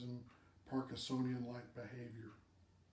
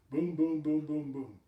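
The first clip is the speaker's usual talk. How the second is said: punchy boom; 2 semitones higher, 8 dB louder than usual. Real, not acted. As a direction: tick off each test with a louder boom. Rapid tests done one after another.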